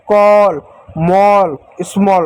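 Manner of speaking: "कॉल", "मॉल", "स्मॉल" each with a round open aw; clear o sound. Shown as a demonstration. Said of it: "ओ की मात्रा पढ़ते हैं". ("स्मॉल" में गोल ऑ की आवाज़)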